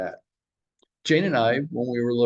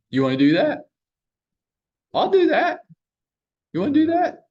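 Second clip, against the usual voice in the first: high, loud playful squeal; mock eager offer; 14 semitones higher, 5 dB louder than usual. Broker mocks a Redfin recruiter pitching agents, high and loud. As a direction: sell it like a cheesy recruiter.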